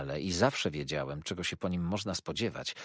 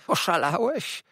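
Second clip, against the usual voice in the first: much higher, louder, shrill with alarm; shocked fawning clerk; 6 dB louder than usual. A narrator's reading. Uriah, slapped by Copperfield, cries out much higher. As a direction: squeal it like a wounded toady. Uriah recoils after being struck.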